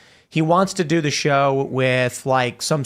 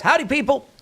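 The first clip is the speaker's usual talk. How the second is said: much higher, sing-out howdy; chirpy greeting; 11 semitones higher than usual. Acted. Host reading a viewer's greeting, high and chirpy. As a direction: read it out high and perky.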